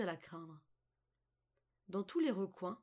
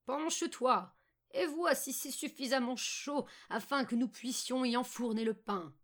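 raised, forceful witch voice; scheming old witch; 4 semitones higher, 4 dB louder than usual. Acted. Witch luring Margot to the oven, raised commanding voice. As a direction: order the girl closer as a scheming witch.

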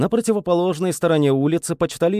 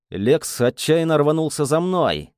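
usual speaking voice

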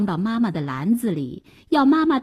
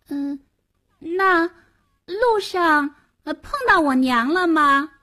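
put-on wary child voice; 8 semitones higher than usual. A narrator's reading. Eldest sister keeps questioning.

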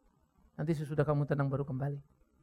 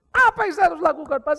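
pleading; 12 semitones higher, 11 dB louder than usual. Retold or imitated. Wife begs pastor for help.

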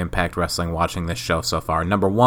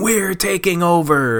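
mocking takeover line; 9 semitones higher than usual. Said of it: "made fun of that by saying".

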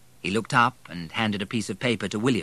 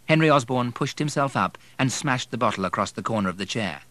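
usual speaking voice

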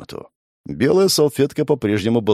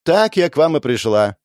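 mocking; 6 semitones higher, 3 dB louder than usual. A narrator's reading. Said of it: "усмешливо откликнулась женщина".

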